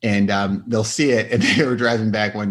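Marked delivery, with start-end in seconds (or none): none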